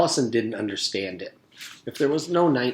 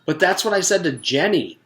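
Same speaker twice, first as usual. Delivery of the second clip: higher, louder whiny protest; 7 semitones higher, 5 dB louder than usual. Acted. Terrence protesting to the teacher, aggrieved. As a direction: argue he's being treated unfairly. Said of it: "Terrence protested".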